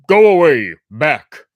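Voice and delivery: loud, gruff order; guards' shout. A narrator's reading. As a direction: bark them away. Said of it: "who told him".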